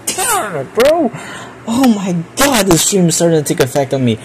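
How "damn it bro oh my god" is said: squeaky high exasperated laugh-cry; laughing exasperation. Real, not acted. Streamer losing it at a crude confession, high laughing exasperation. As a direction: break up in disbelief.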